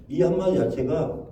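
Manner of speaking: usual speaking voice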